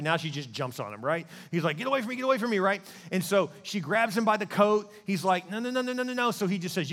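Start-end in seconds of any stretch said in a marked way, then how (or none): 1.8-2.6 higher-pitched, hurried protest; mock panic. Joseph to the woman grabbing him, high mock-panicked plea. Joseph fending off her grab. 5.5-6.3 high, rapid-fire string of protests; mock flustered refusal. Joseph as she grabs his coat, high mock-panicked refusal.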